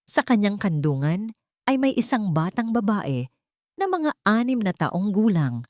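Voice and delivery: usual speaking voice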